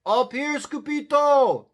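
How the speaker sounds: high, loud little-dog holler; hollering Chihuahuas. A narrator's reading. Chihuahuas call down to Skipito, hollering. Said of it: "hollered the Chihuahuas".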